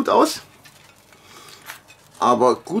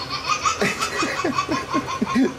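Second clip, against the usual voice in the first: breathy giggle; 11 semitones higher, 5 dB quieter than usual. Real, not acted. Laughs at partner's remark.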